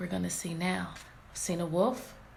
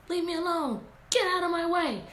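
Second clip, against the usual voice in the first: fussing Red; 8 semitones higher than usual. Retold or imitated. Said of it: "That's what I think she's saying".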